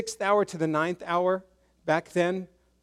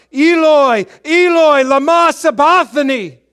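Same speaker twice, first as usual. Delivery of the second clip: loud, high anguished cry; full-voiced cry of despair in Aramaic; 9 semitones higher, 14 dB louder than usual. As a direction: wail it out to the heavens. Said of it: "he cried out".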